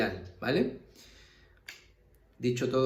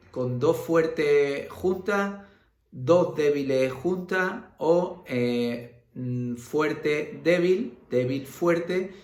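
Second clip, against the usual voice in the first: slow emphasis; 3 semitones higher, much slower than usual. Shown as a demonstration. Teacher listing hiatus types.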